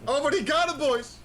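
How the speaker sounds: high, loud thrilled cry; excited shout. Real, not acted. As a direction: cheer the great escape. A last-second escape on the clock.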